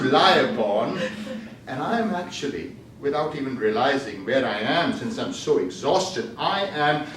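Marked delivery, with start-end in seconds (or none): none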